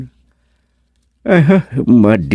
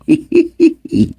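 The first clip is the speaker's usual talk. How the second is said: high laugh. Acted. Character laughs in drama.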